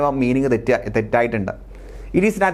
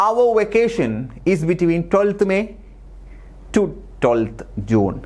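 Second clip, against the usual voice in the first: slow dictation read; 4 semitones higher, much slower than usual. Shown as a demonstration. Reading out exam sentence.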